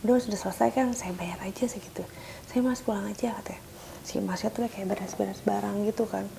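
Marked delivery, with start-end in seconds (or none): 0.1-3.3 hushed, urgent whisper; whispered plea. Retold or imitated. Wife quietly urging the technician to leave, whispered. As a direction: whisper so the husband won't hear.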